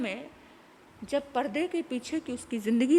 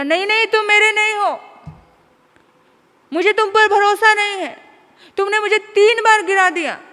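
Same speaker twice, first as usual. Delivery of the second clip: furious rejection; 9 semitones higher, 10 dB louder than usual. Acted. Son rejecting father.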